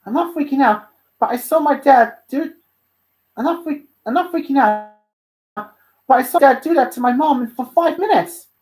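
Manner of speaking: high, loud, flustered voice; surprised and happy. Acted. Dante after Luna's kiss, flustered and happy. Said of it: "he looked surprisingly happy".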